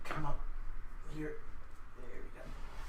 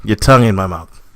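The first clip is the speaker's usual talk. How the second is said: louder, emphatic complaint; louder repeat; 5 semitones lower, 11 dB louder than usual. Real, not acted. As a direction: say it loud so they hear.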